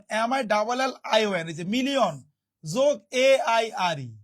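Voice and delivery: usual speaking voice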